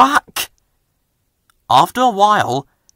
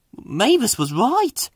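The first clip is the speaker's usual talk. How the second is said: nervous; 10 semitones higher, slower than usual. Acted. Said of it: "he said nervously".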